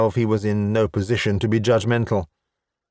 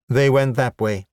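plain reading voice

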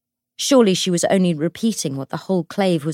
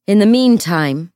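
loud, raised public-address voice; amplified announcement; 3 semitones higher, 10 dB louder than usual. A narrator's reading. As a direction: call it out to the whole room. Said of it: "runes to amplify his voice".

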